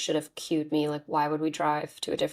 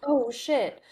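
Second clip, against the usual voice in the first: retold surprise; 7 semitones higher, 3 dB louder than usual. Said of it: "So I was like".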